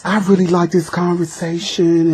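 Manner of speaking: put-on high, falsetto girlish reply; disguised girl voice. Boy answering a stranger on the phone, in a high girlish voice. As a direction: lightly pitch up like a teenage girl. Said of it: "I would disguise my voice".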